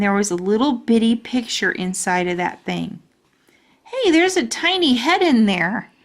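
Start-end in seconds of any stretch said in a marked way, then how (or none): 3.8-5.9 high, loud and thrilled; bursting with discovery; excited. Acted. Junie B spotting the tiny picture in the locket, excited. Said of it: "I said, very excited".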